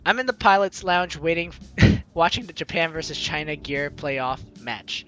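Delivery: usual speaking voice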